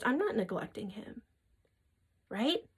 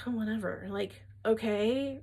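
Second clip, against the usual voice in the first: dismissive shrug; 7 dB quieter than usual. Retold or imitated. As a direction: brush it off like it doesn't matter. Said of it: "masculine usually in the beginning is like".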